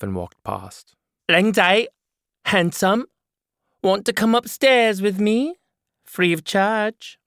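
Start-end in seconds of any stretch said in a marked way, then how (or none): 1.3-7.2 loud, higher come-on, slow at the end; woman calls out a brash invitation, higher; flirty woman character. Acted. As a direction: lure the stranger upstairs.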